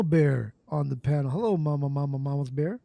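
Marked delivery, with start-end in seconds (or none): none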